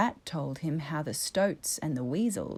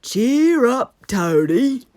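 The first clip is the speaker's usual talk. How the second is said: louder, hearty encouragement; encouraging Badger; 5 semitones higher, 11 dB louder, slower than usual. A narrator's reading. Badger cheering up the despairing Toad, encouraging. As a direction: buck him up, heartily. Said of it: "cried Badger encouragingly".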